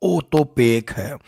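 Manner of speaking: slow Marwari-style delivery; Marwari लहजे में धीरे से. Shown as a demonstration. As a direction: say it slowly the Marwari way. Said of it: "Marwari में कहें तो".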